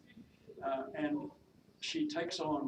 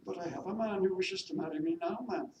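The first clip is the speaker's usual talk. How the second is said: higher-pitched Welsh maid; attempted Welsh accent; 6 semitones higher, 5 dB louder than usual. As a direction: answer as a deferential Welsh servant. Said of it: "my attempt at a Welsh accent".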